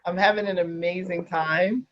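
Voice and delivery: ordinary delivery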